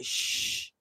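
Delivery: soft voiceless shush, secret kept; playful shush. Acted. Telling viewers to keep the vegan butter a secret, playful shush. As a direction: hush them like sharing a secret. After "don't tell nobody.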